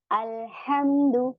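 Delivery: अल्हम्दु slow, with the l of अल clearly sounded; clear lam in al. Shown as a demonstration. Teacher shows the pupils the spoken lam in अल्हम्दु. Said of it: "अब उसमें अल का use हो रहा है तो वहां पे कमरी".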